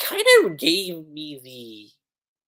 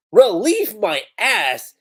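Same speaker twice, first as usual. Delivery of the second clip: loud, raised, drawn-out scoff; indignant retort; 5 semitones higher, 7 dB louder, slower than usual. Acted. Revived character answering Yakoto's relief, indignant retort. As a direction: throw it back loudly and slowly.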